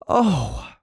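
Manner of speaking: high, startled "Oh!"; a sudden alarmed gasp of a word; distressed high cry. A narrator's reading. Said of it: "cried Muriel in distress".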